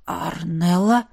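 slow, low, hesitant call; uncertain question. A narrator's reading. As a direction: ask the name in doubt. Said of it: "неуверенно спросил он".

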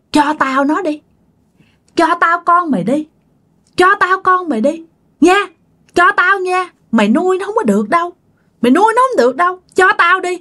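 a ghostly woman asking sweetly but menacing; high eerie female ghost voice; woman's voice. Retold or imitated. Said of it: "Và nói là giọng đàn bà nha".

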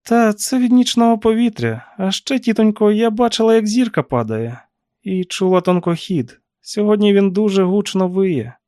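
put-on high-pitched young woman's character voice; niece making excuses. A narrator's reading. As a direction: explain it away to the aunt, pitched high. Niece makes excuses to aunt. (високий голос племінниці, що виправдовується)

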